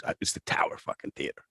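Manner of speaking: awed whisper. Real, not acted. Comic recalling the Tower Theater in Philly, awed whisper. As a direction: breathe it like a sacred name. Awed aside about famous venue.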